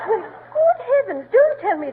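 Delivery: shocked. Acted. Uppington shocked they run paper.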